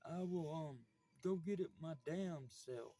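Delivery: dad's gruff refusal. Retold or imitated. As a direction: bark it back as the irritated dad. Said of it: "I said".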